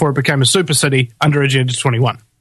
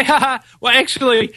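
high, mocking fake laugh and aside; high-voiced parody of smug power; mock gloating; 9 semitones higher than usual. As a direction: sarcastically play gloating rulers, pitched up. Voices gloating rulers to resisters.

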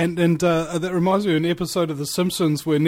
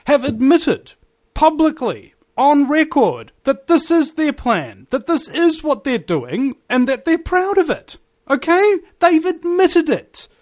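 high-pitched, impassioned argument; emphatic insistence; 9 semitones higher, 3 dB louder than usual. Real, not acted. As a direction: hammer the point home, pitch riding high.

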